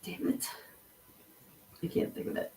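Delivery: whispered under the breath; frustrated whisper. Real, not acted. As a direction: hush it to yourself, annoyed. Searching for a forgotten word.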